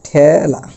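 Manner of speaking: ঠেলা with a drawn-out open æ, "thæ-la"; open-vowel demonstration. Shown as a demonstration. Teacher models the rule for students, ঠেলা with a long open æ. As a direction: stretch the first vowel of ঠেলা as in "cat". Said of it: "অর্থাৎ এরকম".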